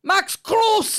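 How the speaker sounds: very high, loud hammed-up commentary cry; over-the-top imitation of match commentary; mock commentator shout. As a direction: shriek the player's name like an excitable commentator. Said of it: "Why do you speak like this?".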